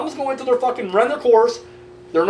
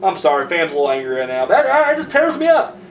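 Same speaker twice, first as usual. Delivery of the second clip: ordinary delivery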